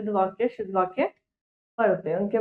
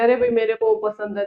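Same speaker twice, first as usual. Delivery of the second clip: casual slangy voice; 11 semitones higher, faster than usual. Retold or imitated. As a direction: pitch jumps much higher for a breezy street-talk imitation. Mimics everyday incorrect casual speech. (बोलचाल की बेपरवाह नकल, ऊँची आवाज़ में)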